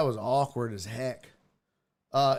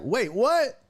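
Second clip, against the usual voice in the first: mock double take, pitched up; imitated surprise; 8 semitones higher, 4 dB louder than usual. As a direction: act startled by news, voice jumping higher. Acting [name]'s surprised reaction.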